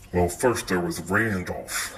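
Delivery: usual speaking voice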